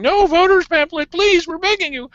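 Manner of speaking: high, whiny mock pleading. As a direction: mimic tiny voices begging in a high whine. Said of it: "in that little whiny voice".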